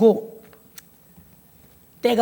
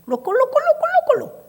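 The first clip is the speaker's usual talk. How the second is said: high gurgling 꿀럭꿀럭 babble; 꿀럭꿀럭 said as a high, odd gurgle; mock tongues babble. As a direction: imitate faked speaking in tongues, high. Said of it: "부러워서 해봤어".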